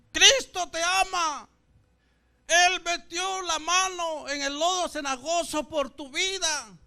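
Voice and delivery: high, loud evangelistic proclamation; street preachers. Retold or imitated. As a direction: imitate preachers calling out to a sinner. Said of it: "los hermanos que me predicaban".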